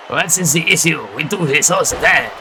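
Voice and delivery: lightly put-on French accent; hint of a French accent as French wrestlers brush off a smoking ban; mock French accent. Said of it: "That's a fake accent".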